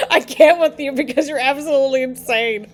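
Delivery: talking through real laughter; can barely get the words out for laughing; laughing disbelief. Real, not acted. To the referee after her shove, laughing disbelief.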